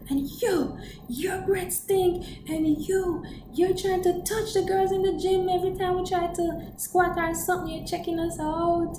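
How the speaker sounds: high-pitched mock drunk accuser; squeaky voice calling everyone out; mocking drunk girl. Drunk teammate exposing everyone.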